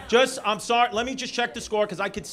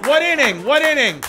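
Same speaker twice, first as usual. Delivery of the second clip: loud, high call to the crowd; 8 semitones higher, 11 dB louder than usual. Real, not acted. Comic calling out to the crowd for the Mets inning. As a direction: shout the question out over the room.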